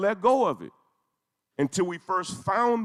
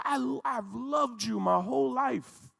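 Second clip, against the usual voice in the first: heartfelt plea; 6 semitones higher than usual. Retold or imitated. Grandmother pleads with Turkey's president, aching and higher. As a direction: pour out lifelong devotion, begging. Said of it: "She said".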